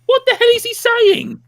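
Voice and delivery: very high, loud exasperation; hammed-up incredulous shout; baffled yell. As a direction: cry out in comic bewilderment. Acting out bafflement at Scottish speech.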